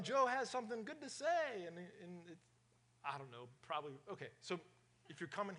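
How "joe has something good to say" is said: mock excited. Teasing about Joe's messages.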